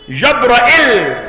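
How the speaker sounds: جبرائيل with fatha on jim and ra, kasra hamza and doubled lam; جبرائيل recited with a clearly doubled closing lam; Ibn Muhaysin's reading. Shown as a demonstration. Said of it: "الجيم والراء وهمزة مكسورة ولام مشددة".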